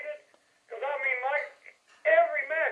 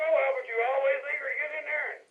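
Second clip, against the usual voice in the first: usual speaking voice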